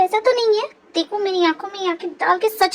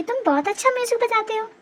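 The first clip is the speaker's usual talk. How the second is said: usual speaking voice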